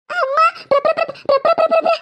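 high, stuck stutter on പ്ര; trying to say ghost but choking on പ്ര; panicked stutter. Acted. Sister cannot say ghost.

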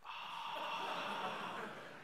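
long, breathy gasp of awe; shocked gasp. Acted. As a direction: gasp as if floored by the answer. Mock shock at the reply.

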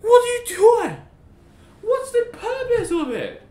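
high, loud, crying shrieks; high wailing rant at a teammate; anguished shrieking. Real, not acted. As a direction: shriek in disbelief at a useless ally. Gamer screaming at ally's blunder.

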